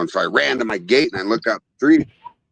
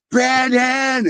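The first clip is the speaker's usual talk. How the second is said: mock yell; 12 semitones higher than usual. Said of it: "Just yell Brandon".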